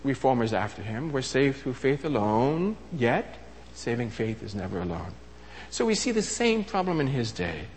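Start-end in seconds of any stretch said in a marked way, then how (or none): 1.1-5.1 slow, soft, weighty slogan; solemn slogan. Shown as a demonstration. Reformers' motto to the congregation, slow and hushed. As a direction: lay the slogan down slowly and softly. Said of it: "he devised this slogan".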